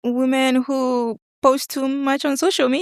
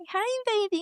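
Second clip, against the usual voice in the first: put-on high, cooing baby talk; cutesy baby voice; 12 semitones higher, 6 dB quieter than usual. Shown as a demonstration. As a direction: coo it like talking to a baby. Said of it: "one of my icks is when they talk in a baby voice".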